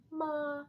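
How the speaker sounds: "ma" high and perfectly level; first tone. Shown as a demonstration. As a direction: sustain "ma" on one high note. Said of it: "it's very flat".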